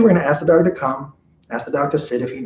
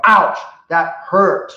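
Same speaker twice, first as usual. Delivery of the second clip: "Ouch" drawn out slowly, stern and pointed; 6 semitones higher, 4 dB louder, much slower than usual. Shown as a demonstration. Trainer showing owners how to say ouch with eye contact, stern and slow. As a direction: stare the dog down and say it slowly. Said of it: "you're going".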